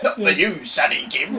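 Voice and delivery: patronising. Retold or imitated. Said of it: "It's like".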